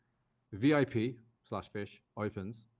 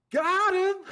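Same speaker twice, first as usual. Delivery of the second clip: high, loud gleeful yell; excited shout; 9 dB louder than usual. Retold or imitated. Friend behind the French guy yelling in glee, a loud high shout. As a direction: blurt it out in triumph. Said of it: "He just goes".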